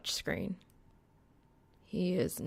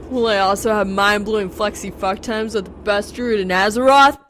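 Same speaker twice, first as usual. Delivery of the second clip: loud, higher, over-the-top gloating; hammed-up boast; 4 semitones higher, 13 dB louder than usual. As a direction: shout it like a cocky bragger. Over-the-top text message read aloud.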